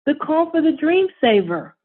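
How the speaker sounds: put-on high, eager boy voice; excited little boy. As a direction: announce it like a proud kid. Child explains eagerly.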